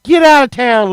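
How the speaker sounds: normal talking voice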